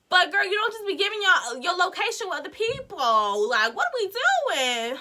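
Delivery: high, loud retold lecture; exasperated scolding. Scolding friend for giving out their location, exasperated scolding. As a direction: lecture her, stretching the final question.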